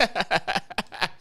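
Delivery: laughter with little voice in it; helpless wheezing laugh. Real, not acted. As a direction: laugh because you can't find the words. Laughs, stuck for words.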